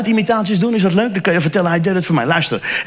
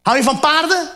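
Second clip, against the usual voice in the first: put-on talking to a child; 6 semitones higher than usual.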